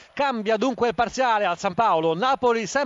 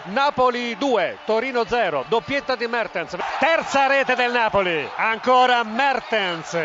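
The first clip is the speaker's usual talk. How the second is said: excited goal call. Real, not acted. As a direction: stretch the score out triumphantly. Goal call in live commentary.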